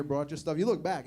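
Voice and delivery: usual speaking voice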